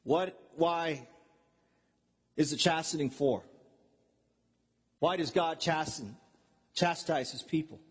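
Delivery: low, measured rhetorical questions from the pulpit; hushed, grave preaching. Real, not acted. Preacher asking the church why God chastens, grave low preaching. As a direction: drop low and let each question weigh.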